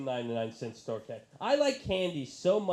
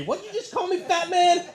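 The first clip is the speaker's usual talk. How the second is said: very high, loud offended shriek; outraged yelling; 8 dB louder than usual. Retold or imitated. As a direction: imitate an insulted lover ordering him out. Acts offended girl throwing him out.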